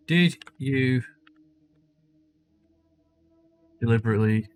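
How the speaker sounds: slow, halting words with long gaps; typing aloud. Real, not acted. Streamer types a question for the game, slow and halting. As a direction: spell it out word by word as you type.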